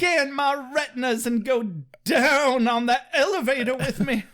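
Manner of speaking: high, overdone seductive parody; milk every innuendo, close to laughing; hammed-up dramatic reading. Mock Buffy inviting Riley into the elevator, hammed-up dramatic reading. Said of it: "It is not dramatic reading worthy".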